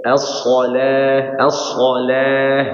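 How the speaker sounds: slow stretched as-shalah; drawn-out demo. Shown as a demonstration. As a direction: draw out as-shalah slowly with a full heavy sad. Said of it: "udah beda".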